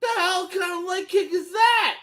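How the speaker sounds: exasperated yell. Real, not acted. Viewer yelling at the fighters on screen, a high exasperated shout. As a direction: holler it in disbelief.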